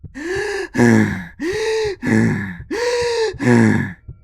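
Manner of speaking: long high strained gasp; a drawn-out gasp of effort; long pained gasp. Acted. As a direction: gasp through the pain. Clenching fist in pain.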